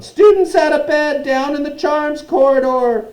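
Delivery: high, full-voiced alarm call; bellowing. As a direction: yell it out as a tattling imp. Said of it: "Peeves bellowed".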